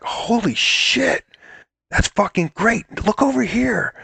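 thrilled director. Retold or imitated. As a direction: gush over the take like a hyped director. Excited director mid-take.

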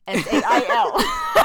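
slow letter-by-letter spelling; spelled out. Shown as a demonstration. To his co-host, spelling out S A I L slowly. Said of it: "The Art of the Sail?".

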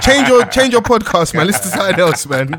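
loud teasing call; mock shout. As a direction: yell it out teasing. Mocking friend's podcast choice.

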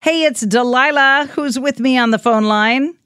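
ordinary talk, nothing marked

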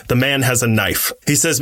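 plain reading voice

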